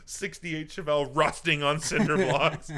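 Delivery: usual speaking voice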